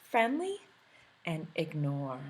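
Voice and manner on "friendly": friendly lifted up high; a teacher naming the next word; bright vocab word. Shown as a demonstration. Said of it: "we're listening to".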